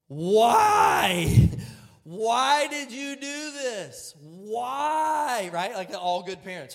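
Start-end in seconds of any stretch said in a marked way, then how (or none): 0.1-5.5 loud, higher, drawn-out cry of why; mock anguished yell. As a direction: wail it like a distraught neat freak. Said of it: "I'm just going".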